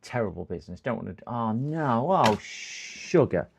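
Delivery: ordinary talk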